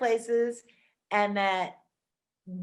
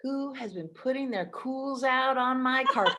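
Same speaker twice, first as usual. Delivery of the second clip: movie quote; 4 semitones higher than usual. Retold or imitated. Quoting a Trading Places line.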